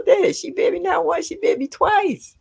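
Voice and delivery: high-pitched, incredulous insistence; indignant emphasis. Real, not acted. Nana on the baby biting her, indignant. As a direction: voice leaps high, hammering the count. Emphatic repeat of being bitten.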